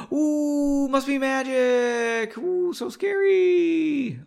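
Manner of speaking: high, slow, drawn-out sing-song ooh; hammed-up ghostly wail, making fun of the magic; mock spooky awe. Mocks his own story's magic.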